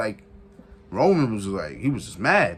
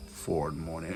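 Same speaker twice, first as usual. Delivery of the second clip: usual speaking voice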